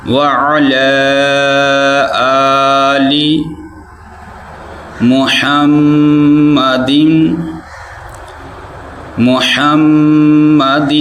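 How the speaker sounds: slow recitation, محمد with a long doubled m; correct recitation. Shown as a demonstration. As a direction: draw out محمد slowly. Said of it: "ایسے پڑھنا ہے".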